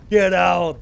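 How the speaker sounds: loud, excited holler; big mock-outraged yell. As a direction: shout it like chasing off intruders. Mock-ordering units out in excitement.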